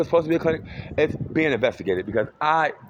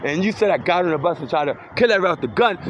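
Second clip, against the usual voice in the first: heated rant; 6 semitones higher than usual. Real, not acted. Angry retort at accusers.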